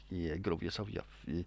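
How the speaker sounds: muttered retort. Retold or imitated. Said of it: "You're like".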